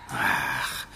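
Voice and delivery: breathy disappointed sigh; defeated breathy ach. Acted. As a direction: let it out as a voiceless sigh. Disappointed, nothing heard.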